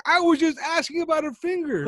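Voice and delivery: high, laughing whine; whiny protest. Retold or imitated. Recruit protests about the finger, laughing whine. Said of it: "He's like".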